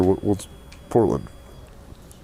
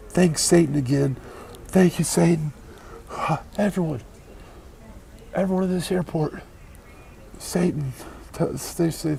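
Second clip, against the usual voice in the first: mock calling out; 10 semitones higher than usual. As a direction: hail Satan at the top of your voice, tongue in cheek. Calling out to Satan in airport.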